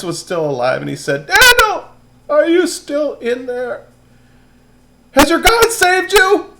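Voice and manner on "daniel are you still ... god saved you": high, loud anxious call. Acted. King calls down into the lions' den, high and loud. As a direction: shout down into the den hoping he's alive.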